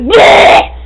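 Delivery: loud high bleh; mock outburst. Girls pretending tics, blurting a loud noise. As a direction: blurt it out suddenly. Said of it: "pretending we had Tourette's".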